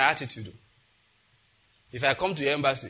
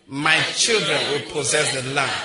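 louder, forceful final declaration; emphatic declaration; 5 dB louder than usual. Shown as a demonstration. Preacher leading the congregation's last repetition, emphatic declaration. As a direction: proclaim it louder for the last round. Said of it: "The last time".

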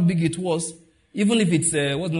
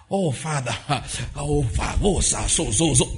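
a hint of an overdone pious wail; hammed-up pleading prayer; mock dramatic prayer voice; mocking showy prayer. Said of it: "There's no".

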